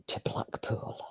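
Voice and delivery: whispered, barely audible confession; hushed admission. Real, not acted. Admitting the move from an affluent area, whispered. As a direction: drop to a whisper for the place name.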